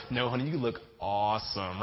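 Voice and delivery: super sweet. Retold or imitated. Husband reassuring morning-breath wife, sweet flattery. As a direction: gently overdo the compliment. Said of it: "super sweet and super nice".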